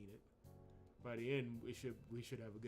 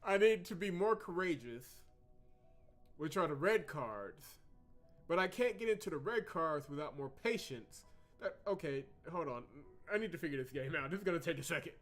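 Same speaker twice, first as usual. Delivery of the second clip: loud, high-pitched working out of a confusing rule; exasperated; 6 semitones higher, 10 dB louder than usual. Real, not acted. Player can't figure out the red cards, loudly exasperated. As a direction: think aloud at full, frustrated volume. Puzzled, exasperated by game rules.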